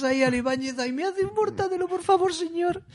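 high, meek begging voice; exaggerated humility. Humble fan asks Ibáñez for a drawing, high meek pleading. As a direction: plead like a tiny awestruck fan. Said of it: "con súper humildad que te cagas".

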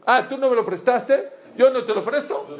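acted tit-for-tat refusal, high and loud; vengeful retort. Neighbour refusing to lend his car in revenge, vindictive. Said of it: "Eso es venganza".

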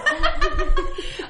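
high loud cackling laugh; real loud laugh; loud burst of laughter. Real, not acted. Big laugh.

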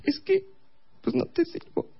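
tiny falsetto mock sulk; offended girlfriend. Girlfriend offended at being called useless, mock sulky falsetto. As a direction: pout in a very high put-on female voice. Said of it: "se enojó, por supuesto que se enojó".

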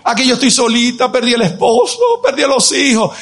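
mock poor-little-widow complaint; theatrical woe-is-me lament; self-pitying lament. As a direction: plead for pity, hamming it up. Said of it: "sacar provecho de su necesidad".

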